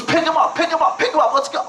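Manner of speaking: high, frantic rallying call; urgent command. Retold or imitated. Officer urging team to carry injured man.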